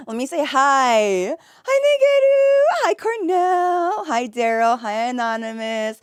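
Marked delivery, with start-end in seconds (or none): 1.6-4.0 excited hi. Real, not acted. Greeting a viewer.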